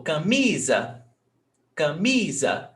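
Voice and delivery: camisa with MI stretched and leaning out; ca-MI-za, lingering on the middle syllable; stress on second syllable. Shown as a demonstration. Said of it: "Mi is the stressed syllable".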